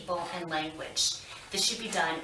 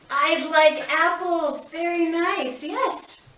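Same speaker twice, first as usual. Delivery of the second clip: excited praise; 11 semitones higher, 7 dB louder, slower than usual. Acted. Therapist praising child's request.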